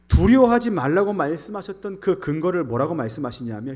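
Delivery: loud, driving sermon voice; forceful preaching. Acted. Pastor urging the church not to fear, loud. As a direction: preach it hard to the congregation.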